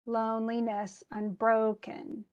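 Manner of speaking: LONE-li-NESS un-BRO-ken, slowly metered; alternating stress. Shown as a demonstration. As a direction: scan Loneliness, unbroken in alternating beats. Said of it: "stressed, unstressed, stressed, unstressed".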